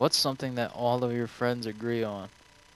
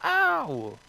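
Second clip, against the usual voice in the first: short, very high mock yelp "Ow!"; sudden high-pitched comic cry of pain; imitated yelp of pain; 3 dB louder than usual. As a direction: squeal it like a hurt wrestling commentator. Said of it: "Jerry Lawler is like".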